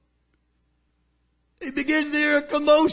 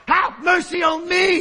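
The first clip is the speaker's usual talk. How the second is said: higher and louder desperate plea; cried out; 6 semitones higher, 3 dB louder than usual. Acted. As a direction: cry it out over the crowd. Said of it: "he cried out".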